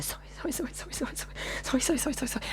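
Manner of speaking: hushed, rapid mimicked string of sorries; meek apologising. Speaker imitating woman apologising after being rebuffed, meek hushed babble. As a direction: imitate her apologising, tiny and soft. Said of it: "And then she went into".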